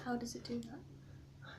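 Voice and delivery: slow whispered question; whispering. Real, not acted. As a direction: breathe it out, unvoiced and slow. Quiet doubting question.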